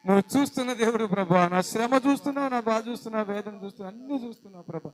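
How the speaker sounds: loud proclaiming prayer; preacher crying out the mother's prayer loudly; full-force preaching prayer. Acted. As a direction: proclaim God who sees.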